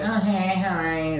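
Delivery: high, offhand mock greeting; very whatever. Recapper imitates an aunt greeting her nephew, very whatever. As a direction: toss off the hello high, as if you don't care. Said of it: "Just very whatever about it".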